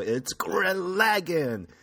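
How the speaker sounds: high, slow, hyped announcement; dramatic reveal. Acted. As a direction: unveil it like an anime climax. Dramatic reveal of anime flag.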